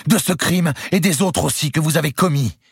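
high, loud indictment; accusing queen. A narrator's reading. Clémence accuses Louis of his crimes, stern. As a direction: condemn him outright.

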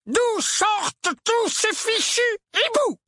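high, drawn-out furious roar. A narrator's reading. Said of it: "rugit-il". (rugissement furieux)

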